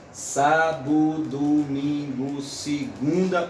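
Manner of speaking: sábado, domingo, segunda very slow, syllables counted; slow scansion. Shown as a demonstration. Lecturer counting syllables for students, very slow. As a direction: spell the line out slowly to land on the seventh. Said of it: "ó, na sétima".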